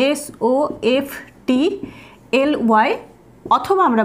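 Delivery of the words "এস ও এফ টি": usual speaking voice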